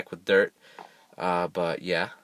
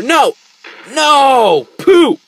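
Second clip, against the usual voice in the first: very high, loud cries of dismay; dismayed yells; 11 dB louder, much slower than usual. Real, not acted. Player watching creeper blow up, high loud wails. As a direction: wail at the loss.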